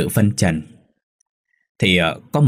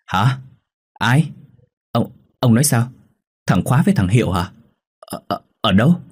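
alarmed rapid-fire questions, pitched up; acted shock, jumping on his words; cutting in; 4 semitones higher than usual. A narrator's reading. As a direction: stammer the questions in disbelief. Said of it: "Bà Lự cướp nhời".

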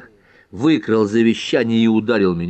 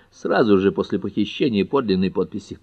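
ordinary delivery

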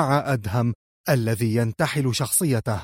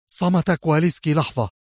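ordinary delivery

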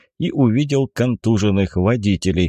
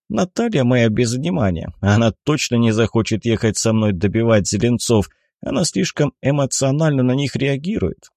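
ordinary delivery, nothing marked

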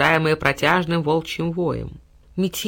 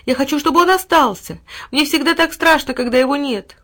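high, louder anxious plea; frightened girl; 8 semitones higher, 5 dB louder than usual. A narrator's reading. Little girl begging to keep the dog, high and anxious. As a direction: plead for your dog.

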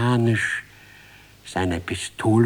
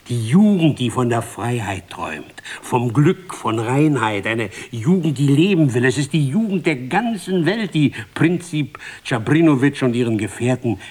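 passionate oration; 4 semitones higher, 5 dB louder than usual. A narrator's reading. Narrator builds a passionate oration.

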